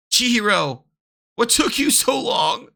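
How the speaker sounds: put-on a kid calling to a friend; lightly voiced child calling.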